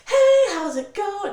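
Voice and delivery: high, loud, drawn-out sing-out greeting; perky and happy. Retold or imitated. Mom answering her child's nightly call, perky and happy. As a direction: imitate a perky mom picking up. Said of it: "She's all perky and happy".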